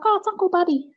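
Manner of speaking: put-on high little-kid voice, matter-of-fact; toddler voice. Toddler telling mum the dead uncle is here, high chirpy child voice. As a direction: play the small daughter, chirpy and casual. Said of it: "And she's like".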